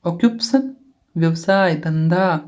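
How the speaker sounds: ordinary talk, nothing marked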